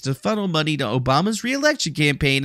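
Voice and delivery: ordinary talk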